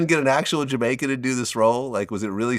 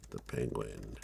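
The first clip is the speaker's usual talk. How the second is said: much softer and lower, under his breath while typing the search; muttered to himself; 18 dB quieter, slower than usual. Real, not acted. Host searching online for the director, muttering quietly. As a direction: mumble it to yourself as you look it up.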